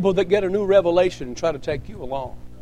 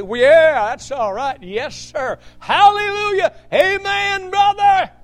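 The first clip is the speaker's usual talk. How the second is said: a mock congregant building to a high, loud hallelujah; happy churchgoer affirming the preacher, ending high and loud; imitating an amen response; 10 semitones higher, 5 dB louder than usual. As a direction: play the pew answering back, climbing into a shout. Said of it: "And, and you say".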